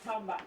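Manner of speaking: "talking about" run together as "talm-bout"; Southern vernacular. Retold or imitated. Woman quoting a writer's misspelled Southern slang, Southern vernacular. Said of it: "that's a Southern kind of vernacular".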